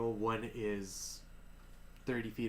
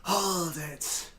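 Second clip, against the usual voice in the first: commanding shout; 6 semitones higher, 5 dB louder than usual. Acted. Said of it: "you hear a voice go".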